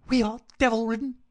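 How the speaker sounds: high, loud, agitated vicar in bursts; frantic gasping cry. A narrator's reading. Frightened vicar bursts in on Holmes, frantic. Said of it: "in gasps and bursts".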